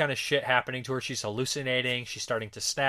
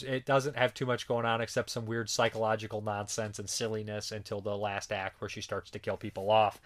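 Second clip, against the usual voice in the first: usual speaking voice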